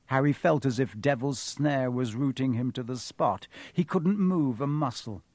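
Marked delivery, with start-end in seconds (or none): none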